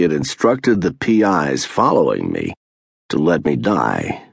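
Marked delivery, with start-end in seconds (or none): none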